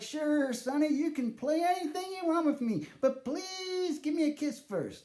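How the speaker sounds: put-on high, sweet old lady voice; old granny voice. Nana asking her grandson for a kiss, high granny voice. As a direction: coo it like a doting granny. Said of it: "She's like".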